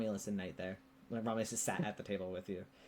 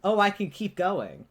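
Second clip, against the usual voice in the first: eager excitement; 8 semitones higher, 10 dB louder, slower than usual. Retold or imitated. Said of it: "that moment when you're like".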